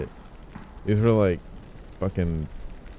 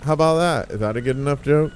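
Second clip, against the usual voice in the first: mock comedian rant; 7 semitones higher than usual. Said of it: "it's like".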